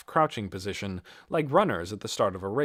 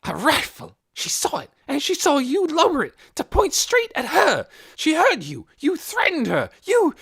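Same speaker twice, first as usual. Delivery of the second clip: loud, high furious accusation; breathless fury; 9 dB louder than usual. A narrator's reading. Missus Beeson accusing Hoyt, breathless and furious. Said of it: "breathless, furious voice".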